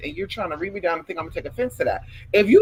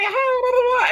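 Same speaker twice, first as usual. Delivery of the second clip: high, bubbly mock chatter; imitating an excited Sagittarius man; excited imitation; 4 dB louder than usual. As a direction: bounce it up high and giddy. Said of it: "They get a little excited".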